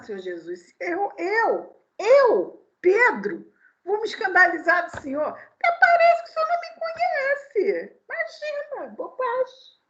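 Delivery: high, indignant mock Peter voice; boastful Peter. Preacher voicing Peter protesting to Jesus, high and indignant. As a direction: play Peter scoffing at the idea.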